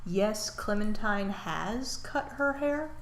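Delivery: slow, deliberate; slow reveal. Shown as a demonstration. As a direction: spell it out slowly. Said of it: "slow deliberate reveal".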